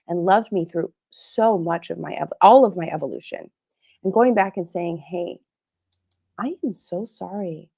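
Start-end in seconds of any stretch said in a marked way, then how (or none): 5.0-7.7 soft apology. Retold or imitated. Retold apology to family.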